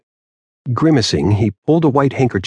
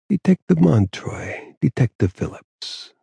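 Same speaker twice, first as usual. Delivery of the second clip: slow, rasping mob boss greeting; ailing Don greeting the detectives raspily; raspy voice; 6 semitones higher, 3 dB quieter, slower than usual. A narrator's reading. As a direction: let the words grate out slowly. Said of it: "he said in a raspy voice".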